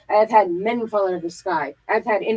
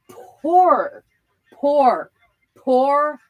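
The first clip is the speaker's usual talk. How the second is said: building pour; 3 semitones higher, 5 dB louder, much slower than usual. Real, not acted. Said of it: "dramatic pouring".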